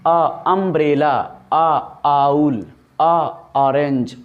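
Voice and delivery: अ held long before अम्ब्रेला, आउल, ऑरेंज; slow, stretched अ openings; drawn-out vowels. Shown as a demonstration. Teacher letting students hear the opening vowels. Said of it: "ये इसलिए लग रहा है".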